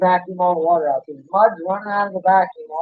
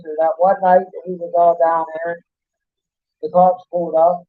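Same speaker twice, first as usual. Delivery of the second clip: normal talking voice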